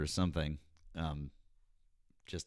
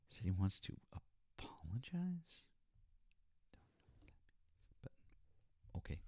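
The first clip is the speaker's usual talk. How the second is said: whisper; 16 dB quieter than usual. Real, not acted. Puzzled aside after odd call.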